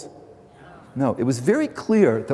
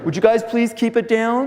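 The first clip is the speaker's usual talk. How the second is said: raised, higher call to the next room; calling through a wall; 8 semitones higher, 3 dB louder than usual. Retold or imitated. Speaker calling to fighting neighbours next door, raised voice. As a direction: pitch it up and out so they hear.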